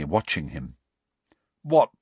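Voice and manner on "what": thick, through a mouthful of food; mouth full. A narrator's reading. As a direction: talk round a bite of sausage. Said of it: "he said thickly".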